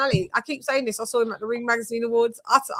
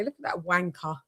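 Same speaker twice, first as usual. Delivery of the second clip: muttered aside; 4 semitones lower, 8 dB quieter than usual. Retold or imitated. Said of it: "I said to Shay".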